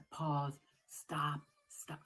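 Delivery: loud, punchy stop command; dramatic halt. Pretend. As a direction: call a sudden halt, hammed-up. Halting the Flavor Train dramatically.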